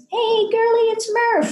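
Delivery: high, chirpy friend's greeting voice; Murph. Retold or imitated. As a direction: pitch it way up like a cheerful old friend calling. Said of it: "and she goes".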